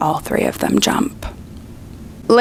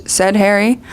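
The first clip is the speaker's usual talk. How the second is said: ordinary talk, nothing marked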